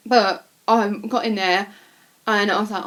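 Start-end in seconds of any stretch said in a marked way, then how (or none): none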